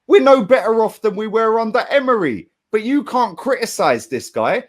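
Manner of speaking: ordinary talk, nothing marked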